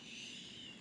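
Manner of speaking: a long gentle shh; soft shushing. Acted. Librarian hushing the children, soft shush. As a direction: hush the room softly.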